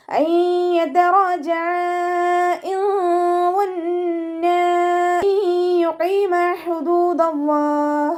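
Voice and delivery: hoher, langsam gedehnter Tajweed-Gesang; Koranrezitation. Acted. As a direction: die Verse feierlich singend vortragen. Chants Quran verses in tajweed.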